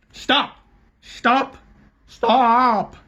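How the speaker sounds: high, loud repeated cries; doctor halts the fight; shouting stop. A narrator's reading. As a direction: shout them apart.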